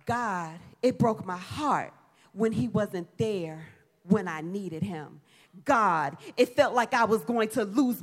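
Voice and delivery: low, hushed confession to God; soft, halting, with pauses between phrases; heartfelt prayer. Acted. Preacher models getting real with God about heartbreak, hushed vulnerable prayer. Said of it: "get real like that with God".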